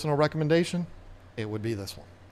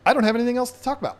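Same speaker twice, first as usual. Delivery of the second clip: fast wrap-up; 8 semitones higher, 4 dB louder, much faster than usual. Real, not acted. Rushed wrap-up joke.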